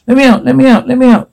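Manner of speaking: high, insistent repeated plea; desperate begging. Acted. Trapped character begs for release, desperate begging. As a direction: beg frantically up high without shouting.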